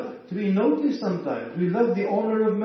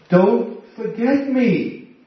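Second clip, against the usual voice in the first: Joseph pleading; 6 semitones higher, 6 dB louder, slower than usual. Retold or imitated.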